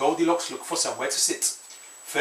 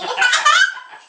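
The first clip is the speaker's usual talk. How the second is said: high laugh; 7 dB louder than usual. Real, not acted. Mother laughs at child.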